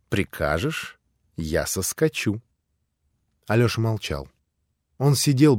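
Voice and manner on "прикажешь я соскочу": soft, lower, rising tender offer; coaxing. A narrator's reading. Grushenka on Alyosha's lap offers softly, rising, tender. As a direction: coax him gently, lips close.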